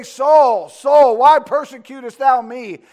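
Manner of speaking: loud calling from heaven; heavenly voice. Acted. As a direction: call out the name like thunder. Acting the voice from heaven.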